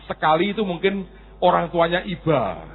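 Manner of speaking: usual speaking voice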